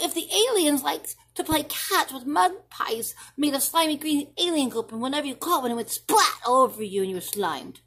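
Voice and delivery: put-on a kid bursting with a wild idea; excited child.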